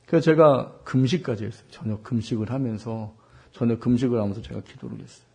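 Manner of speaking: ordinary talk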